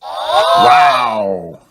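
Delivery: high, loud, drawn-out wow; whole family bowled over; amazed cheer. Acted. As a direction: let out a big high-pitched gasp of wonder. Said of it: "says everyone".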